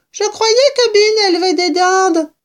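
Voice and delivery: put-on very high, loud child's voice; little fox cub. A narrator's reading. Small cub puzzled about the turkeys, high piping voice. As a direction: pipe it like a puzzled youngster.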